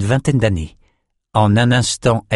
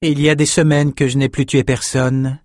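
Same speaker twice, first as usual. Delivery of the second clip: unruffled calm; 5 semitones higher than usual. A narrator's reading. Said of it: "dit Nietzsche imperturbable".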